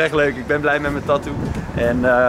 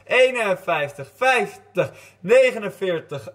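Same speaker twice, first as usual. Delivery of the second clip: counting down; 5 semitones higher, slower than usual. Real, not acted. Counting down for hide and seek.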